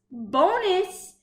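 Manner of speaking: plain reading voice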